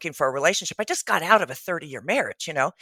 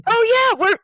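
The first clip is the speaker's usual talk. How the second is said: eager; 6 dB louder than usual. Real, not acted. Guest answers eagerly.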